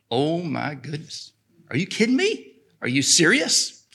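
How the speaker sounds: a woman hearing she is forgiven, amazed; amazed disbelief. Retold or imitated. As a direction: voice leaps high in stunned disbelief.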